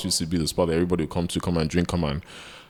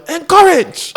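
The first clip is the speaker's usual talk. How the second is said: laughing shout; 11 dB louder, slower than usual. Retold or imitated. Friend's suggestion retold with laughter.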